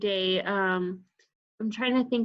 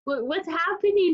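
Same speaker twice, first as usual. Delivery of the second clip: 1 mock confused; 7 semitones higher than usual. Voicing Brandon mocking her.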